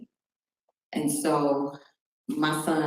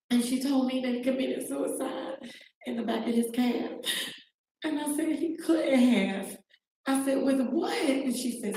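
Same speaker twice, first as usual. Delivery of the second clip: real tearful voice; 9 semitones higher than usual. Real, not acted. Mother recounts being told how her son died, crying. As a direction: keep talking through tears, pitch raised and easing down.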